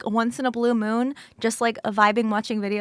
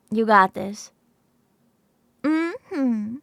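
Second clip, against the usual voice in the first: normal talking voice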